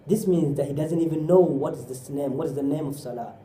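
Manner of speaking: usual speaking voice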